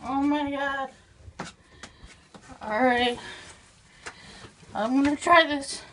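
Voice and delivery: normal talking voice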